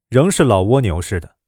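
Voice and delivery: plain reading voice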